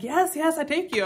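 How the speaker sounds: high-pitched imitated Thai accent; mock Thai accent. Local man confirming the tour to a tourist, mock Thai accent. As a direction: agree eagerly like a Thai driver. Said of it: "go into a bit of an accent".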